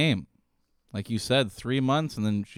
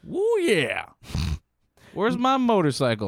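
a hint of a loud trucker; lightly hammed good ol' boy; 8 semitones higher, 4 dB louder than usual. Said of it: "with, like, a trucker hat and some dip in your lip".

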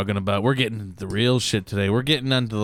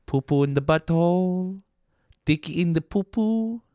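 hammed-up French accent, a bit higher; mock French accent; 3 semitones higher than usual. Riffing on Pop-Tarts to his cohost in a fake French accent. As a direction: explain like a silly Frenchman. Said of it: "That's why I did that accent".

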